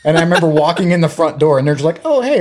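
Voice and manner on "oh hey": much higher, breezy hello; mock innocent family greeting; casual greeting. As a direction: greet like nothing happened.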